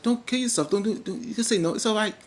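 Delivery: lightly high, gentle mock reassurance; imitating a soothing pastor. Voicing Jabari reassuring Chad.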